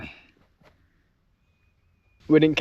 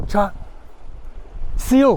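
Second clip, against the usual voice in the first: excited; 8 semitones higher, 5 dB louder than usual. Real, not acted. Calling out, spotting seal.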